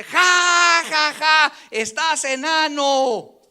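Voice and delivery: high loud jeering laugh-taunt; mocking taunt. Bully taunting the short kid, loud high jeer. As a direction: sneer it out, slow on the insult.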